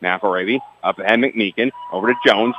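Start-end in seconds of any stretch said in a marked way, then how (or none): none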